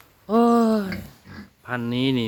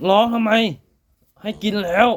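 scolding pigs; 7 semitones higher than usual. Real, not acted. Farmer scolding noisy piglets for squealing, raised call.

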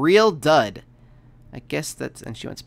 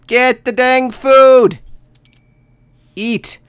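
much higher and louder, slowly drawn out; frantic; 10 semitones higher, 14 dB louder, much slower than usual. Real, not acted. Player begs the starving Sim to eat, slow frantic yell. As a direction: stretch each word in desperation.

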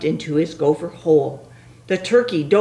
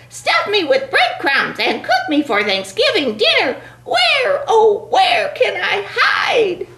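panicky turkey voice; 3 dB louder than usual. Acted.

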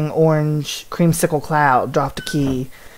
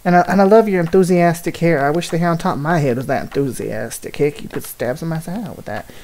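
ordinary delivery

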